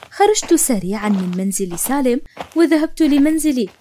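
ordinary delivery

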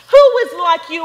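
first "Who is like you" rung out loud and high; loud proclamation. Acted. Preacher reading Exodus to the congregation, ringing loud. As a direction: proclaim the "Who" to the whole church. Repeated scripture line, loud then quiet.

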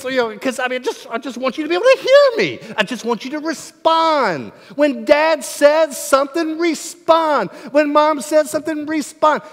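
loud, high-pitched frustrated rant; exasperated parent. Acted. Parent ranting at child who won't respond, exasperated. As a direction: vent as a parent who has been ignored.

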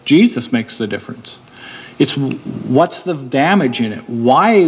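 normal talking voice

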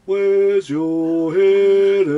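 mock dramatic echo. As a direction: stretch it out like a soap cliché. Echoing the show's line mockingly.

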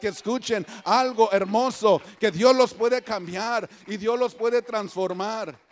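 loud, emphatic preaching. Real, not acted. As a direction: preach it out with conviction. (predicación fuerte y enfática)

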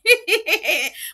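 loud laughing burst, high; a high cackle of real laughter. Real, not acted. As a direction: burst out laughing at your own line. Laugh after own joke.

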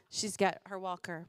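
normal talking voice

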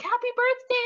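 high excited birthday wish; bright high happy birthday; cheerful greeting. Retold or imitated. Quoting voice actor's message.

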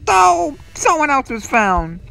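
sudden high "Oh!" then alarmed news; high startled exclamation; startled gasp. Real, not acted. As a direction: jump at the discovery. Teammate caught in horror game.

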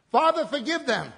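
loud preaching of Jesus' prayer; proclaimed aloud. Acted. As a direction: cry it out to heaven.

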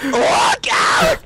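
high warning yell; alarm shout. Real, not acted. Warning partner in game.

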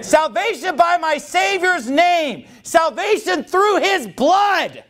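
high, slow, drawn-out declaration; impassioned preaching. Real, not acted. Preacher proclaims hymn lines to the congregation, slow high fervour. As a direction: proclaim each phrase with full conviction.

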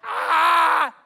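short loud open-mouthed "Ah!" yell; mock battle scream. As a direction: scream like soldiers surprising a camp. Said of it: "they're screaming".

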